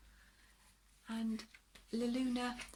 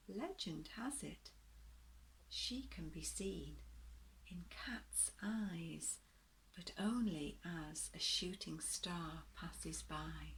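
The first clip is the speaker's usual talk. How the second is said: gentle bedtime story reading, rising and falling; storybook narration. A narrator's reading. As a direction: read it like a picture book to little ones.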